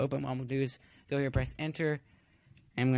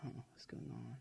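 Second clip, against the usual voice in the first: puzzled whisper to himself; 17 dB quieter than usual. Real, not acted. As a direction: whisper it, half to yourself. Muttering at the slow build.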